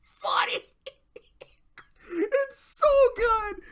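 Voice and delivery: words squeezed out through laughter; breathless laugh-talk. Real, not acted. Laughing at the skit.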